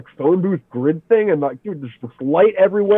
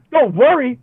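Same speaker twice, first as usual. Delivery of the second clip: high, disbelieving echo of don't worry; incredulous; 9 semitones higher, 3 dB louder than usual. Retold or imitated. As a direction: fling it back in outraged disbelief. Throws their words back.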